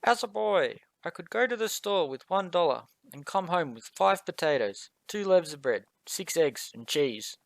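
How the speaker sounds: put-on high, creaky old man voice; quavery grandpa voice. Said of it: "put on my best old man voice".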